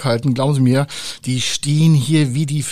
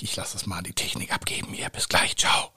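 soft whisper; 5 semitones lower, 7 dB quieter than usual. Real, not acted. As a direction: whisper a quick goodbye. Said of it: "ich bin jetzt mal ganz leise".

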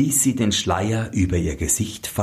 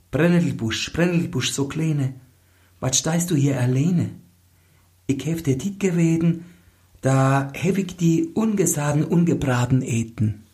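lightly raised, sing-song recited verse; gently chanted folk verse, a touch higher; maiden's rhyme; 7 semitones higher than usual. Acted. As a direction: recite the little rhyme to the nettle bush. Maleen recites her rhyme.

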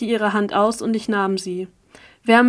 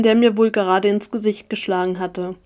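usual speaking voice

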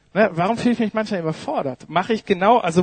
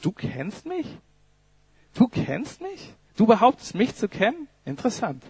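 ironic master; 3 semitones higher than usual. Acted. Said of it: "was die Antwort ist".

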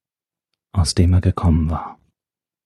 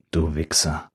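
soft, low contemptuous mutter; muttered insult; 4 semitones lower, 8 dB quieter than usual. Acted. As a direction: spit it quietly under the breath. Inner curse at the professor.

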